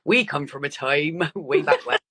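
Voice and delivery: jokey put-on Dorset burr with a laugh; Dorset accent. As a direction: slip playfully into a Dorset accent. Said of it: "I don't know why that requires, like, a Dorset accent".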